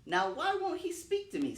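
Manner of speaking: a high, fretful snake wondering aloud; python talking to himself. Acted. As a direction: python muttering to himself, voiced high and puzzled. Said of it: "said the python to himself".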